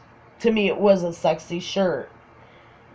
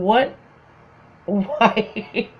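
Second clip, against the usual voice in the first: higher, incredulous mock questions; imitated laughing disbelief; 4 semitones higher than usual. Friend laughing at her shirt, laughing disbelief. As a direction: act out asking helplessly through laughter. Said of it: "He's laughing".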